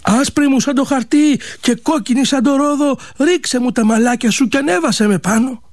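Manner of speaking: high sing-song call to the window, lightly voiced; a hint of a young prince's high call. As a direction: pitch it up and call out to the girl above. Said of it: "φωνάζει κι αυτό ό,τι είχε ακούσει".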